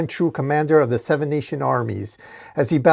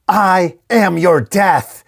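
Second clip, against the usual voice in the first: slow, louder, higher roar; screaming; 5 semitones higher, 5 dB louder, much slower than usual. A narrator's reading. Glowing Spear lifting Dork to finish him, a slow scream. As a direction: pound out each word slowly.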